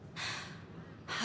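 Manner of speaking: long, soft breathy sigh; weary sigh. Acted. Worker about to apologise to the newcomer, weary sigh. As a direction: let out a heavy sigh before apologising.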